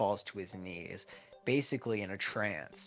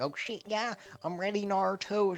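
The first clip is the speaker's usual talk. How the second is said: put-on higher, eager girl voice; lightly voiced Sakura; 10 semitones higher than usual. Sakura answering Naruto before the forest, higher and eager. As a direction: chirp it like an eager teen.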